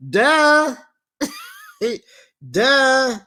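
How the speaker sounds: high, loud mocking duh. Real, not acted. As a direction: make it dripping with obviousness. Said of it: "mocking obvious".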